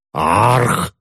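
drawn-out growl of frustration; frustrated uncle's growl. A narrator's reading. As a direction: growl out a long "argh". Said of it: "прорычал дядюшка".

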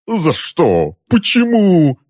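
high-pitched drawn-out wail; anguished wailing questions; howling. A narrator's reading. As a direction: howl it like a martyr. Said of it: "провыл дядюшка".

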